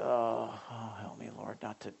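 low, soft retold plea; muttered prayer. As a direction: pray under your breath for restraint.

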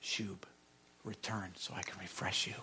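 soft whispered invitation. Real, not acted. The preacher voicing God's gentle call, whispered. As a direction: lean in and breathe it.

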